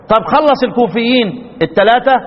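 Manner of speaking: usual speaking voice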